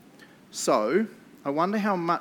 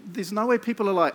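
ordinary delivery

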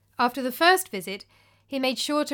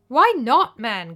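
cried out; 10 dB louder, slower than usual. Acted. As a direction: burst out in exasperation. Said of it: "cried Paschasius".

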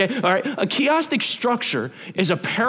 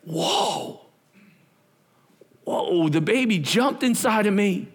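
amazed Elizabeth; 3 semitones higher, slower than usual. Retold or imitated. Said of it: "Elizabeth goes".

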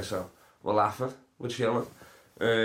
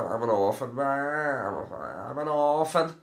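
mock orphan whine; 5 semitones higher than usual. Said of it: "their catchphrase".

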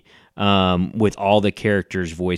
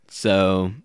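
ordinary talk, nothing marked

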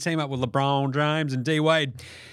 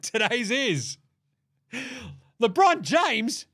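announcer; 7 semitones higher, much slower than usual. Acted. Announcing with drawn-out flourish.

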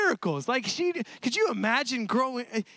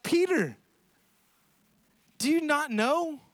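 raised, slow, exasperated question; exasperated reproof; 6 semitones higher, slower than usual. Acted. Jesus to Peter after the sword, exasperated reproof. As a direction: call the name, then slowly press the point.

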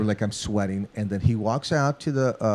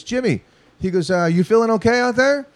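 higher, concerned voice through the booth; producer checking in; 11 semitones higher, 5 dB louder than usual. Retold or imitated. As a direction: ask the shaky drummer if he's alright. Producer checks on nervous drummer.

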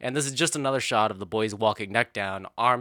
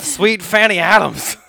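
drawn out and loud, higher than usual; mock British exclamation; 7 semitones higher, 9 dB louder, slower than usual. Reader hamming up a copy-pasted old saying, mock exclamation. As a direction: belt it out slowly as a silly British saying.

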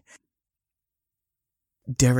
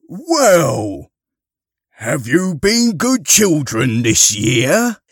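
loud, hearty, higher voice; Father Christmas; 7 semitones higher, 8 dB louder, slower than usual. Acted. Harry as Santa questions the children, booming. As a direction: boom it out to a roomful of children.

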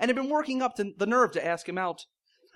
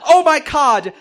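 higher, much louder ecstatic cry; 7 semitones higher, 12 dB louder, slower than usual. Acted. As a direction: let it burst out in pleasure. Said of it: "she cried".

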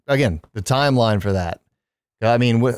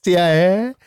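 giggly C I A; 8 semitones higher, slower than usual. Real, not acted. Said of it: "I can't even say it now".

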